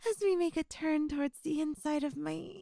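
normal talking voice

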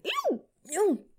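high squeal of surprise. Acted. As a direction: squeal it, caught off guard.

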